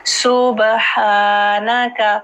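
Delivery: सुबहानका with long held "haa"; careful, unhurried सुबहानका, every sound clear; slow model pronunciation. Shown as a demonstration. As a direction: draw out सुबहानका syllable by syllable. Teacher models corrected word.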